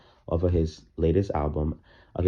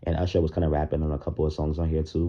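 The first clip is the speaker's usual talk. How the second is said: ordinary talk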